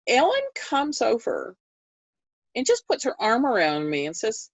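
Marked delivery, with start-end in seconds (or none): none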